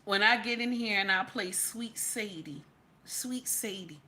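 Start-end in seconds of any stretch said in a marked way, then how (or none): 1.2-4.0 breathy whispered aside, no voice. Real, not acted. Streamer whispers to her chat about playing a song. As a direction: drop to a whisper as if sharing a secret.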